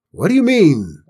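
high, loud, bullying demand; shouting. A narrator's reading. Stable boys jerk Cinders awake, shouting. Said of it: "they shouted".

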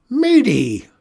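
loud, high shout of her name; old witch shouting for the runaway; calling out. A narrator's reading. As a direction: call her from afar. Calling out for the missing girl.

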